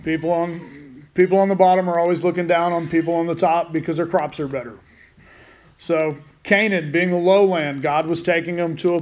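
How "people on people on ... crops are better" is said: usual speaking voice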